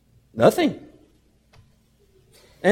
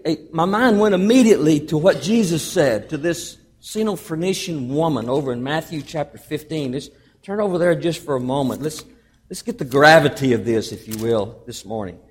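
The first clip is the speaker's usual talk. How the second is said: ordinary talk, nothing marked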